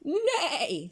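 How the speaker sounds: high neigh; whinny like a horse. Acted. The horse speaks.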